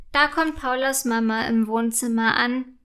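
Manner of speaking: ordinary delivery, nothing marked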